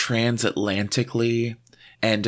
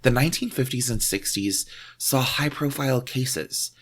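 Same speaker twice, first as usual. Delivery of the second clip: normal talking voice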